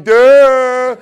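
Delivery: loud, high dopey duh; a loud dopey groan of a word; dumb "duh". Retold or imitated. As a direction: play it slow-witted. Students mocked as dull.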